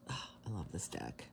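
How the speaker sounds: soft, low whisper of delight; loving whisper. Real, not acted. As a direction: whisper it to the deck like a secret. Admiring her tarot deck.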